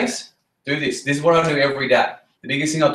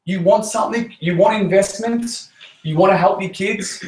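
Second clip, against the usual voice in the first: fired-up push; 6 semitones higher than usual. Real, not acted.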